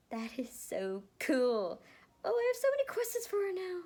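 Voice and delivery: put-on high, loud starstruck boy; excited. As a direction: gush like an amazed fan. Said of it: "large with excitement".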